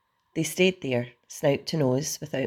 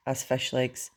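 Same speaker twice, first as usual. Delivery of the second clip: ordinary delivery, nothing marked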